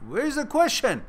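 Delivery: loud, high exasperated cry. Real, not acted. Streamer can't find the viewer's question, loud and exasperated. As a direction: throw your hands up searching for it.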